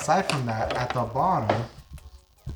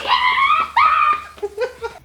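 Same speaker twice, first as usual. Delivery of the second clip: loud burst of laughter; big laugh; 8 dB louder than usual. Real, not acted. After water sprays.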